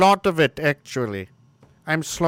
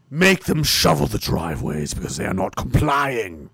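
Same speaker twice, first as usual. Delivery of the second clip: sarcastic mock order; 3 semitones lower than usual. Streamer mocking lockdown rules with a sneer. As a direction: sneer it like a petty tyrant.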